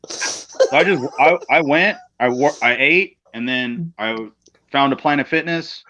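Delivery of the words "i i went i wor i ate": usual speaking voice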